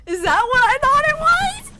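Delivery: very high, loud shriek; screamed disbelief. Real, not acted. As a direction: shriek the question in total shock. Screaming at the reveal.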